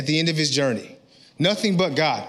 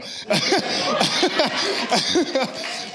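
laugh; 5 semitones higher than usual. Real, not acted. Laughs at his own excitement.

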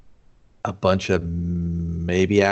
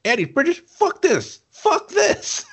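very high-pitched hammed-up indignant rant; mock outrage; slower than usual. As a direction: squeal the protest, climbing higher with each outburst. Acted outraged audience reaction to casting.

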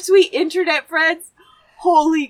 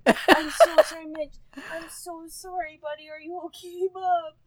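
much softer, slightly raised pet-comforting voice; tender soothing; 3 semitones higher, 5 dB quieter than usual. Real, not acted. Daughter to scared dog Mitch after thunder, tender.

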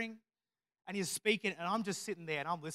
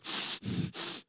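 scared speaker's noisy nasal breathing; heavy nose breathing; 4 dB quieter than usual. Retold or imitated. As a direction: breathe heavily through your nose into the mic. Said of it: "breathe very heavily through my nose".

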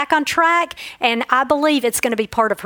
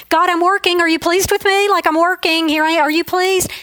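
anxious pleading; 5 semitones higher than usual. Retold or imitated. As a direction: beg for approval like an anxious child. Pleading to God, imagined.

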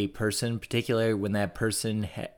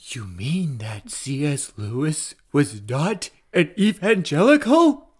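hushed slow start rising to a high, loud squeal; mock shock; 4 semitones higher, 5 dB louder, slower than usual. Co-host feigning horror over Lewis's denomination, mock shock. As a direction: build from scandalised whisper to shrieked last word.

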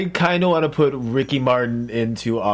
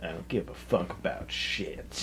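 whispered; 7 semitones lower, 14 dB quieter, slower than usual. Real, not acted. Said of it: "I don't know why I said it that way".

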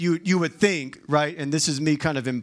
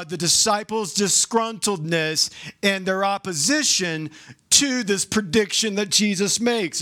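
usual speaking voice

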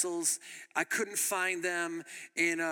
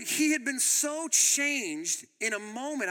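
ordinary delivery

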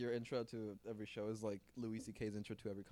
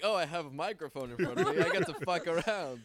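laughing; 6 semitones higher, 7 dB louder than usual. Retold or imitated. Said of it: "I'm like".

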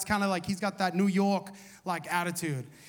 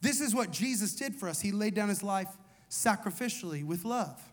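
ordinary talk

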